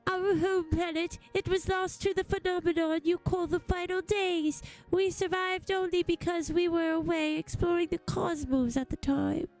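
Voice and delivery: earnest, theatrical tale of loss; mournful alien survivor. Acted. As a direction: recount a lost home with solemn drama. Voices alien's backstory.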